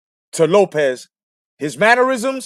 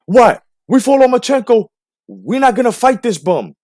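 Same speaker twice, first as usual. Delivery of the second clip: higher, brash imitation of the father; hammed-up tough dad bragging; mock Lopez Senior; 7 semitones higher, 5 dB louder than usual. Host mocking the boxer's father in higher voice. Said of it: "Senior, that is, saying".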